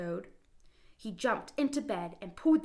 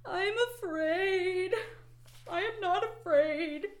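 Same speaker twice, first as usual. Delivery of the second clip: high, slow, louder frightened cry; scared cry; 12 semitones higher, 4 dB louder, slower than usual. Acted. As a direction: quaver it out while shaking. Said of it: "he cried".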